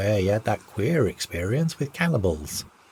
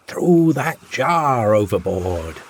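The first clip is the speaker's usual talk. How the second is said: slow, louder shouted command; yelled out loud; 2 semitones higher, 5 dB louder, slower than usual. A narrator's reading. Captain yelling to the crew to dump the jar. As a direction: bellow the order across the ice. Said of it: "So I yelled out loud".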